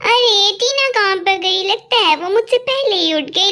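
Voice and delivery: normal talking voice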